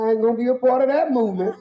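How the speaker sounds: high, fast, loud punchline; comic vow to stay out of it, fast and loud; excited punchline. Real, not acted. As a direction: rush the punchline out.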